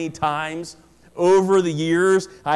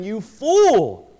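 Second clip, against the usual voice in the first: a sharp, louder rebuke; divine rebuke; 5 dB louder than usual. Retold or imitated. God rebuking the rich man, a sharp loud cry. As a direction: call out the fool with sudden force. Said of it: "But God said to him".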